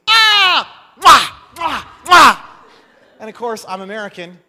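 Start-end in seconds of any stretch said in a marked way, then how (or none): 0.1-2.4 loud, high exuberant kissing sounds; hearty Middle Eastern host kissing loudly; loud greeting kisses. Retold or imitated. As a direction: smack big loud cheek kisses with a shout. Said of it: "and he goes".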